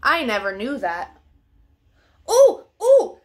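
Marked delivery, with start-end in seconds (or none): 2.3-3.2 high, loud excited dog squeals; excited ooh. Acted. Dog spotting playmates, excited high squeal. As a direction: squeal with puppy delight.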